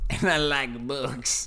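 slow, put-on mocking voice; slow mocking repeat. Mocking his friend's outro line in a slow put-on voice. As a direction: parrot it back slowly in a silly voice.

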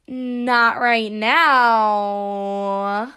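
very slow, drawn-out rising announcement; podcast title. Acted. Host announcing her podcast's name. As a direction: stretch the show name out.

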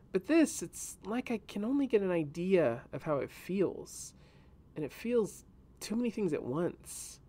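put-on a girl trying to explain a sad feeling; young girl voice. A narrator's reading. Reyna telling Kadok about the root ball, gently sad.

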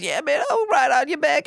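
high, casual put-on scorpion voice. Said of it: "that scorpion says".

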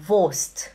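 Wurst as voscht, no R; German pronunciation. Shown as a demonstration. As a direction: Wurst said short with a silent R. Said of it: "Você fala".

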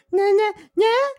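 put-on very high tiny baby voice; a baby voiced by a friend; squeaky baby voice. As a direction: play a baby babbling. Voicing the baby.

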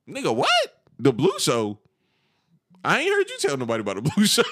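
acting out stunned confusion, a laugh slipping in; incredulous. His own incredulous retold reply.

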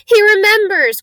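high, loud, thrilled exclamation; excited cry. A narrator's reading. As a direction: cry it out in delighted amazement. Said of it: "cried Daedalus Diggle".

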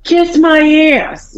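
high, slow sassy retort; mock defiant teenager; teen sassing back at her dad; bratty teen.

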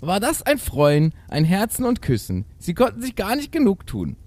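high, animated fairy-tale narration; theatrical storytelling. A narrator's reading. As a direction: read it with exaggerated storybook delight. Reads the tale aloud. (überschwängliche Märchenerzählung)

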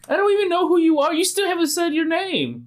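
very high, rushed protest; high-pitched, fast objection; indignant protest. Acted. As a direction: squawk back in indignation. Player argues back at the god.

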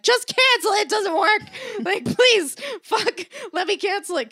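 high loud exasperated plea to cancel; exasperated shout. Retold or imitated. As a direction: beg to be let out, at full volume. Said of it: "I'm like".

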